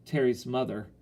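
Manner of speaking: ordinary delivery, nothing marked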